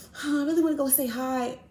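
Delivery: high, sighing wish to go say hi; mock longing friend. Homegirl wanting to greet the girl, high mock sigh.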